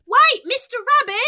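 high, loud call after someone. Acted. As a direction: shout after the fleeing rabbit. Calling after fleeing rabbit.

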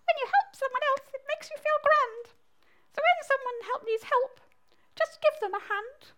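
high-pitched squeaky frog. Acted. Frog teaches baby mole to help, high squeaky voice. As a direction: chirp the advice high as a frog. Frog repeats the mouse's advice.

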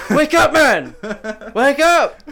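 high, loud pleading yells; desperate shout. Acted. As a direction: shake him and scream at him. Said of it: "He shakes him".

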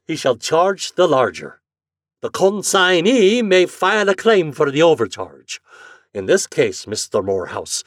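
stubborn Irish agent, loud; Irish brogue. Acted. Flannery reading the rule to Morehouse in a brogue.

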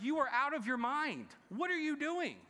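high, loud, mock-exasperated outburst; exasperated family member. Family member scolding a loved one, high mock exasperation. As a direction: play the fed-up relative. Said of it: "we're like".

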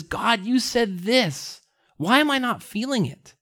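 high-pitched, anguished question to God; despairing plea. Retold or imitated. Psalmist in despair asking God why, pleading. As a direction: plead upward in mild despair. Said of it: "driven further into despair until".

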